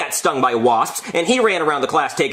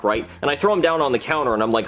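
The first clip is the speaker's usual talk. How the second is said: normal talking voice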